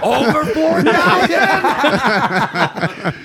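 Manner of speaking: high laughing words, breaking up. Real, not acted. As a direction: talk through real laughter. Laughing through the story.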